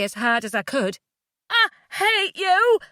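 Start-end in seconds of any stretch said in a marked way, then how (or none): 1.5-2.8 high, drawn-out furious scream; loud, anguished rage; screamed hatred. A narrator's reading. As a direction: scream it right at him. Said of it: "I screamed".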